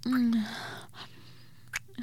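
ordinary delivery

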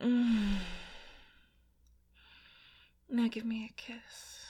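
breathy intimate whisper, close to the mic; sensual ASMR whisper. Acted.

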